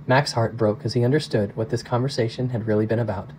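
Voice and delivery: usual speaking voice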